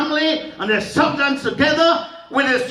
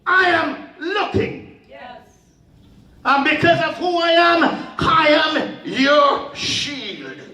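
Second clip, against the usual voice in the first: high, slow intoned preaching; 7 semitones higher, slower than usual. Acted. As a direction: draw it out, ringing and high. Preacher voicing God, high.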